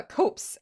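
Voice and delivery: "copse" said with a short o, like hops; "copse" rhymes with hops; correct pronunciation. Shown as a demonstration. Looking up the word and reading out "copse" with a short o. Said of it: "it's pronounced".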